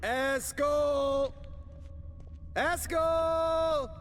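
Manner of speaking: calling out. Acted. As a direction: shout his name across the hall. Calling out for friend.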